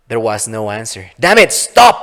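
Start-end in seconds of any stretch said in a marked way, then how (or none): 1.2-2.0 much higher, loud yelled command; angry shout. Acted. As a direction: bark it across the room. Laura commands fleeing fairy.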